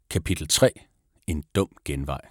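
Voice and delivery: plain reading voice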